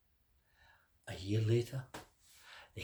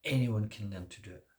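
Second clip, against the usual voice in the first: usual speaking voice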